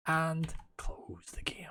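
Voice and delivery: slow breathy whisper. Acted. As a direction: whisper the sign-off slowly. Hushed sign-off aside.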